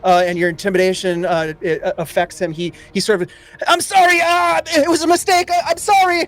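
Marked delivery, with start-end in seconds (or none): 3.7-6.3 very high, whimpering begging; hammed-up terrified wail; cowering plea. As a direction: beg for your life in a squeaky panic. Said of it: "he cowers as you race up".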